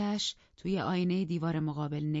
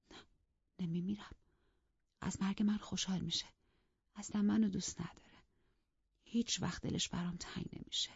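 softer, half-whispered bitter mutter; 4 semitones higher, 5 dB quieter than usual. A narrator's reading. Mistress muttering bitterly to herself, soft under-breath mutter. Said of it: "زیر لب گفت".

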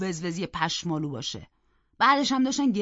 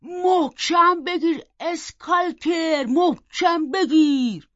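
high, drawn-out yell to a dog; shouted command; 7 semitones higher, 7 dB louder, much slower than usual. A narrator's reading. Servant with lantern urges the dog Skulker on, shouting. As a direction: shout it slowly, stretching the last word. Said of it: "اومد و داد زد".

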